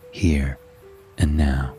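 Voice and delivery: plain reading voice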